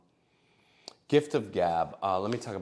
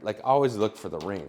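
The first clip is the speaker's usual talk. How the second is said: ordinary talk, nothing marked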